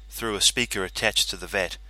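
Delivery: usual speaking voice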